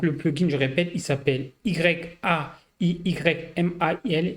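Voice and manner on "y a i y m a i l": slow, letter by letter; each letter set apart, unhurried; spelling it out. Real, not acted. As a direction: spell the name out slowly for the viewer. Spells out the plugin's name.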